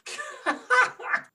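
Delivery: high put-on laugh; a knowing old dwarf's chuckle.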